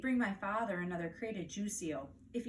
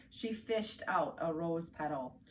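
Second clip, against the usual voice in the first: ordinary delivery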